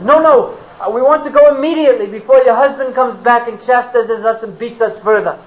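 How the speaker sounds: high, loud anxious pleading; frightened hurry. Acted. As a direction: rush the words, eager to flee. Boys hurrying to leave, frightened.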